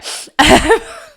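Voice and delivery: a high rising laugh through tears; tearful laugh. Real, not acted. Moving on from crying about dad, tearful laugh. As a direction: giggle away the tears.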